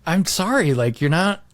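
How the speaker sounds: normal talking voice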